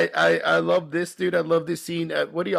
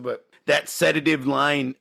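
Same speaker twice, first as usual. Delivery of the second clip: usual speaking voice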